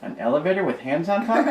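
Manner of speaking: put-on high little-kid voice; puzzled question. A narrator's reading. Little brother garbling the words, high and puzzled. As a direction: ask it all confused. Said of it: "questioned Davin".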